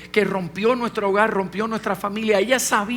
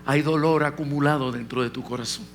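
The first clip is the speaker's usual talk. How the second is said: lower, calm voice of God speaking; the Holy Spirit speaks to the preacher, lower and calm; 6 semitones lower, 3 dB quieter than usual. Retold or imitated. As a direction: answer him low and steady, as the Spirit. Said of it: "El Espíritu Santo me dijo".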